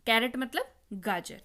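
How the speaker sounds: ordinary talk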